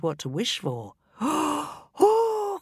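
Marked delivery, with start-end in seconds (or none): 1.1-1.2 gasp. A narrator's reading. 1.2-2.6 high excited oh; excited cry. A narrator's reading. Wife thrilled by the wishes, crying out high. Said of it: "cried Missus Smith".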